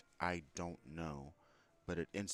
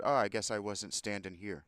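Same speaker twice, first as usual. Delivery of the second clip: loud, raised pointed jab; pointed complaint; 4 semitones higher, 10 dB louder than usual. Retold or imitated. Customer confronting the man who cut in line, pointed complaint. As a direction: make sure the line-cutter hears it.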